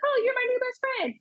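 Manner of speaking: put-on high-pitched, gushing little-kid greeting; excited new-friend greeting. Preschooler to a total stranger on arrival, excited and high-pitched.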